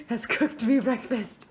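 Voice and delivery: loud, laughing delivery. Real, not acted. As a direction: laugh through the words. Teasing friend, laughing as she tells.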